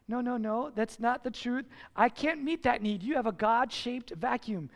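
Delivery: usual speaking voice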